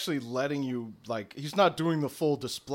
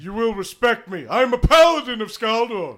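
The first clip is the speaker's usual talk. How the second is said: higher and louder, grandly declaimed; pompous proclamation; 8 semitones higher, 10 dB louder than usual. Acted. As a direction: demand respect like a proud knight. Paladin demands respect.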